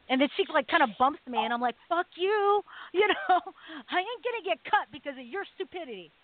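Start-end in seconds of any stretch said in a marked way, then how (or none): 1.9-6.1 higher, half-laughing mock outburst; retold snap. Narrator snaps at his friend for pushing him forward, a mock outburst with a laugh. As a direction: snap at her, laughing at the absurdity.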